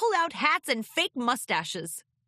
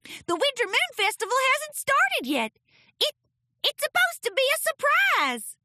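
high, loud stammering filly; flustered filly; 5 dB louder than usual. Acted. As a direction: stumble over your words, dismayed. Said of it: "Apple Bloom yelped".

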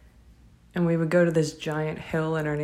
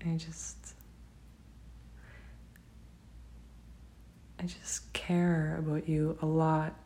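slow sincere whisper; 6 dB quieter, slower than usual. Real, not acted. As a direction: confess it barely voiced. Heartfelt confession to partner.

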